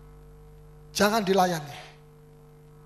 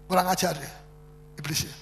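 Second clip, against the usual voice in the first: usual speaking voice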